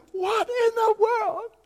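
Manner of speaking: mock wail, very high and drawn out; crying. Wife crying at husband over found retainer, high slow wail. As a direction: imitate a wife bawling in disbelief. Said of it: "She's crying".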